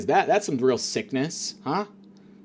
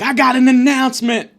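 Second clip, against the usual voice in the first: loud announcing; 10 semitones higher, 8 dB louder than usual. Retold or imitated. Train man announcing.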